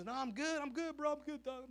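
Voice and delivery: high, bright fake "I'm good"; fake okay. Crying boy tells friends he is fine, high and breezy. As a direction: brush them off with a cheerful front. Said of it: "eso es lo que yo".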